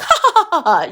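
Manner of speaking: high, silly fake cackle; mock giggle. Teen girl joking with her friend, mock giggle. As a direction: laugh it up like a goofy teen.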